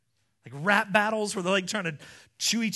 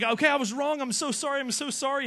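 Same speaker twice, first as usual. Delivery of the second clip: higher, hurried apology; pleading apology; 6 semitones higher, faster than usual. Acted. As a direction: back down fast, begging him to stop. Acting Job begging to stop.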